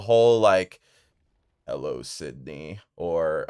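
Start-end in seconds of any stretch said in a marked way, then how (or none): 1.7-2.8 low, quiet phone-menace voice; Ghostface. Acted. Imitating the slasher villain's phone voice, low and menacing. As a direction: drop low and soft, like a killer on the line. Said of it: "like, the iconic voice".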